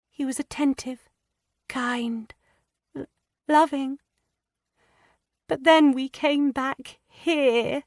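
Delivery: put-on high, hesitant girlish voice, stammering; a frightened young wife struggling to confess; young distressed woman. A narrator's reading. Lydia hesitantly confiding her fears to Anne.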